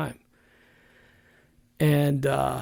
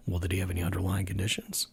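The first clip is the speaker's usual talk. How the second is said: hushed whisper; 6 semitones lower, 7 dB quieter, faster than usual. Retold or imitated. People prying about her husband's death, whispered. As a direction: drop to a mock hushed whisper. People quizzing the widowed sister-in-law.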